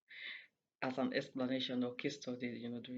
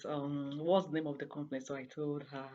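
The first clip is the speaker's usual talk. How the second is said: ordinary delivery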